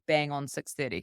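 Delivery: normal talking voice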